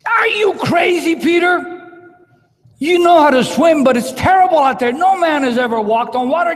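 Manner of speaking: loud, high jeering yell; mocking critics' shout. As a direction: scoff at Peter from the boat. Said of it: "critics in the boat piped up".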